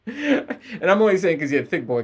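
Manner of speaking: laughing through the words. Real, not acted. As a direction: giggle as you say it. Laughing at own joke.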